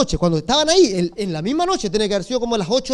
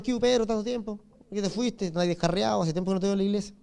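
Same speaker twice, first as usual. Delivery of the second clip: put-on much softer, offhand hello to an old friend; soft casual greeting; 3 semitones lower, 10 dB quieter, faster than usual. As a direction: toss it off quietly. Discípulos saludan a Pedro, casual. (saludo suave y casual a Pedro)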